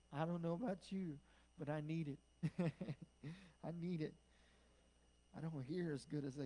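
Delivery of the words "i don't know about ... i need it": ordinary delivery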